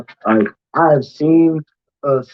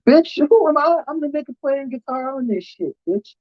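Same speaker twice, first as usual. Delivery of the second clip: indignant comeback; 9 semitones higher than usual. Retold or imitated. Guitarist retelling his comeback to the singer, high and indignant. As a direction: fire back at the singer, offended. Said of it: "And I was like".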